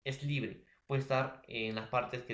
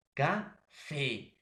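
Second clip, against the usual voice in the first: "café" split in two, long stressed "fé"; stress on last syllable. Shown as a demonstration. Teacher giving an aguda example, last syllable stressed in "café". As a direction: pause after "ca", then stretch "fé". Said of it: "la mayor fuerza de voz en la última sílaba".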